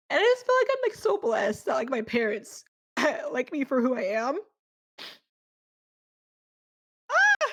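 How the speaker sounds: overwhelmed, laughing through happy tears; crying happy tears. Real, not acted. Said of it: "I'm crying happy tears".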